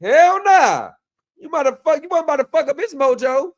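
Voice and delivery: high, loud, sassy put-on voice scolding; mock incredulous scolding. Host mocking the woman from the clip in a high, loud sassy voice. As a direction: clap back at her like a disbelieving auntie.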